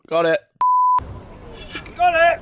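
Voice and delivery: loud, high, growing cheer; excited. Real, not acted. Excited outburst over nickname.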